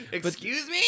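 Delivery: high, loud mock indignation; mock outrage. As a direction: squawk it as if offended. Mock offence at joking remark.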